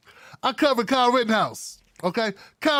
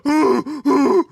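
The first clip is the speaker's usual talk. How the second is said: loud, higher fake crying gasps; mock sobbing gasp; 6 semitones higher, 7 dB louder than usual. Host mimics Kyle crying on the stand, mock sobbing gasps. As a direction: imitate exaggerated sobbing breaths.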